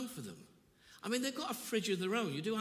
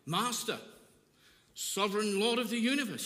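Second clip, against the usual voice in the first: grand formal address; 3 semitones higher, 6 dB louder than usual. Pretend. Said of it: "And he doesn't say".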